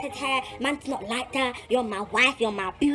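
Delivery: usual speaking voice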